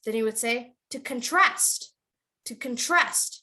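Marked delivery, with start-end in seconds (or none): 0.9-1.8 "contrast" as con-TRAST; light con, strong TRAST in "contrast"; stress on second syllable. Shown as a demonstration. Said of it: "But if it's a verb". 2.5-3.3 "contrast" said con-TRAST; stress on second syllable. Shown as a demonstration. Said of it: "But if it's a verb".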